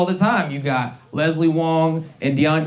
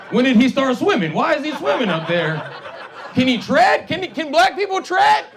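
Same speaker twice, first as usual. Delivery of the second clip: high, rising, baffled questions building to a shout; mock confused audience; 6 semitones higher than usual. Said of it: "Y'all look at me like".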